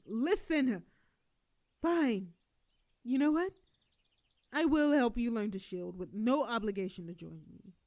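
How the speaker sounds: loud exasperated surrender; exasperated shout. Acted. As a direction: give in loudly, out of patience. Exasperated giving in.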